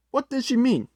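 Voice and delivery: usual speaking voice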